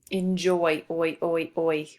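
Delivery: "Enjoy" with its final "oi" echoed three times; isolating the sound. Shown as a demonstration. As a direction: say "Enjoy", then repeat just the oi sound. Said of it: "We hear that oi sound".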